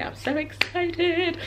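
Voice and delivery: high, drawn-out little squeal; sing-song excitement; excited. Real, not acted. Excited about the writing.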